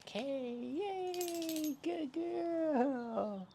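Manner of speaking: very high sing-song cheering; high-pitched dog praise. Real, not acted.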